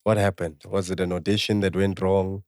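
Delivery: ordinary talk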